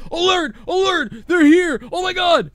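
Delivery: high mock-panicked alarm; hammed-up frantic shouts; Kurt Angle. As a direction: yell it like a siren. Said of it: "Kurt Angle wouldn't be like".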